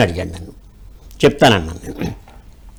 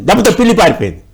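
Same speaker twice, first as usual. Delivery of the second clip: ordinary talk, nothing marked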